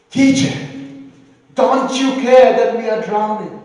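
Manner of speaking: frightened disciples yelling to wake their teacher; panicked shout. Retold or imitated. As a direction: scream it over the storm at him. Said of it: "They said".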